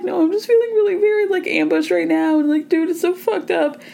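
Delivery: high, whiny imitation of a hurt girl; mocking upset Maddie. Retelling Maddie crying to Trevor, in a high whiny mock voice.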